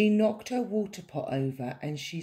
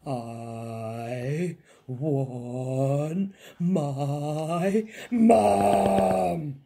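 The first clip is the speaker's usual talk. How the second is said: slow, swelling wail bursting on Mum; screamed wail; 5 dB louder, much slower than usual. A narrator's reading. Princess afraid of the monster, screaming for Mum. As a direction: stretch each word, then yell Mum. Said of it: "she screamed".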